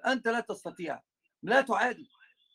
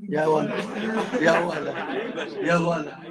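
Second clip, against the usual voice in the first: loud repeated call across the room; 3 semitones lower, 5 dB louder, slower than usual. Real, not acted. Sheikh calls out loudly to a student across the room. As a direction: call him over, loudly and sharply.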